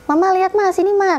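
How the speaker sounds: mock yell calling Mama from upstairs; yelling for mom. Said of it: "teriak-teriak manggil mamaku".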